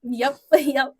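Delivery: laughing through yep; laughing along loudly. Real, not acted. Laughing at shared joke.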